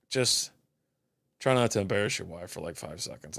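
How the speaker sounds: soft, low aside to the drooling dog; muttered to the dog. Retold or imitated. Man quietly tells the drooling dog to behave, soft mutter. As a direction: hush it down like a private word to the dog.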